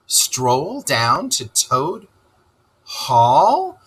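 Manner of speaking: slow, rising disbelief; excited Rat. Acted. Rat astonished at Toad's plan, excited disbelief. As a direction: repeat his words in astonishment. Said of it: "cried the rat, greatly excited".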